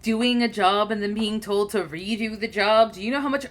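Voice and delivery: ordinary talk, nothing marked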